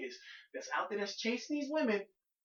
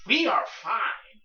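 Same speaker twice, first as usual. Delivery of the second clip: snooty brush-off; 3 semitones higher, 10 dB louder, much slower than usual. Retold or imitated. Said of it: "you come over like".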